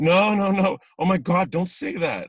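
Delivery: high, loud mock-horrified protest; horrified interjection. Casting director stopping an actor's painful story, horrified. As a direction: cut in aghast, voice shot up high.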